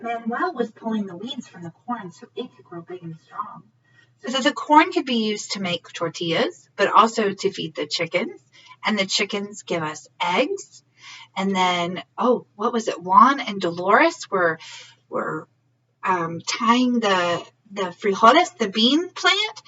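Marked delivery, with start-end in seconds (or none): none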